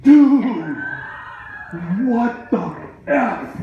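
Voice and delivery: loud, high, drawn-out disbelief with a laugh; imitating friend yelling in laughing shock; stunned shout. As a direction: shout it at your buddy like he's lost his mind. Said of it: "he's like".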